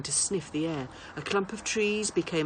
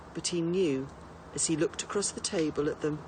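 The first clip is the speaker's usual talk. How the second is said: ordinary delivery, nothing marked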